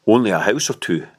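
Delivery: ordinary delivery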